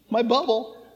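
guard your personal space; mock anxious listener. A lightly voiced churchgoer, asked to join a group, whimpers mockingly.

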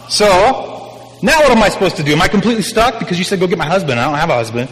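sarcastic gloat. Acted. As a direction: rub his nose in it.

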